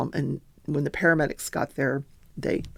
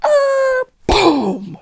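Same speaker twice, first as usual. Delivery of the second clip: high screech then a loud boom, imitated; imitated crash; 8 dB louder than usual. Heard from bed, a loud mock crash noise. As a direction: mimic the crash that woke her. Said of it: "and I hear this".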